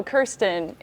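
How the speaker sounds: ordinary delivery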